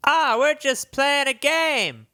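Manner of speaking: high-pitched put-on prince voice; high, put-on princely protest. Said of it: "says the prince".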